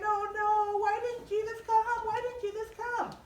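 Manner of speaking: mock crying. Sisters at the tomb, high crying wail. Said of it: "and they're crying".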